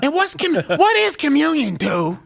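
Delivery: mocking Whoopi's scolding; Whoopi impression. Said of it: "she's like".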